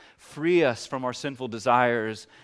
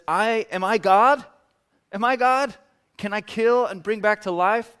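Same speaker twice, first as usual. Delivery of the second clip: panicked king; 8 semitones higher, 7 dB louder than usual. Retold or imitated. King panicking at Naaman's request.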